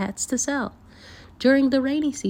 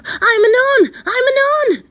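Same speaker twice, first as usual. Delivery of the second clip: frantic high shout, much louder; crying out; 10 dB louder than usual. Acted. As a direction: call out desperately for help. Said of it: "he cried out".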